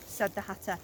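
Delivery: ordinary delivery, nothing marked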